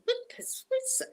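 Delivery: high, hushed, puzzled squeak; mock bafflement. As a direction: play utterly baffled in a small high voice. Acted baffled reaction to odd pictures.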